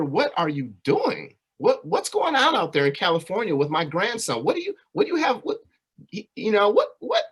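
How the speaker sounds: high, rising, bewildered grandmother voice; grandmother's frantic questions. Retold or imitated. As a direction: squeak out the worried grandmother's questions. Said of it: "specifically my grandmother, asking my mother".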